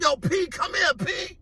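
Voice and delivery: high call across the block; high retold holler at P. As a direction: shout for P to come over. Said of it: "So he like".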